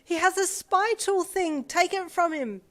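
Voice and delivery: high loud slowed plea; girl calling through door; urgent calling. A narrator's reading. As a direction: shout for help. Girl urging from room.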